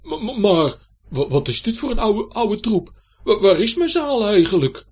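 high, loud, stuttering dismay; shocked stammer. A narrator's reading. Knight sees his hall in decay, shocked stuttering cry. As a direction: cry out aghast, stumbling on words. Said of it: "wijd open van schrik".

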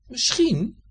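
cat voice. A narrator's reading. Cat pondering the hedgehog's problem, high voice. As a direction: think aloud as the clever cat. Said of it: "zei hij".

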